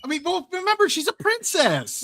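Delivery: mock prissy princess.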